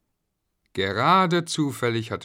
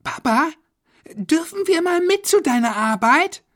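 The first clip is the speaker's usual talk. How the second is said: put-on very high little-boy voice; squeaky, eager kid's question; child asking; 8 dB louder than usual. A narrator's reading. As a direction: ask Papa like a small child, loud and high. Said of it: "Florian fragte".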